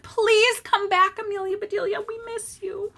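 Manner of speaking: pleading. Acted. Missus Rogers begging Amelia to return, high and pleading. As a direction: coax her back sweetly.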